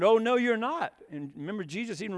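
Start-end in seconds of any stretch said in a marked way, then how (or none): 0.0-0.9 higher, louder protest; flatly refuse, loud and high; mock indignant no; emphatic refusal. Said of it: "And he said".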